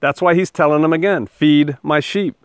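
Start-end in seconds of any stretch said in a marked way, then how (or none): 1.2-2.3 slow command. Acted. Jesus repeats his command.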